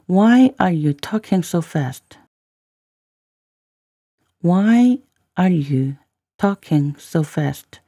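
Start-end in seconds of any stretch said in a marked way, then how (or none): 4.4-7.8 very slow, with a break after "Why are you"; careful slow model reading; slow, chunked demo. Shown as a demonstration. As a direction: draw it out for learners, phrase by phrase. Teacher repeats line in slow chunks.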